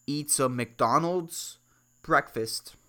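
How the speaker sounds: usual speaking voice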